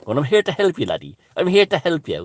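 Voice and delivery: high, loud mock-Irish leprechaun; cartoonish Irish leprechaun, pitch leaping up; leprechaun accent. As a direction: squeal it like a stage-Irish leprechaun. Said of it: "with a, like, a leprechaun accent".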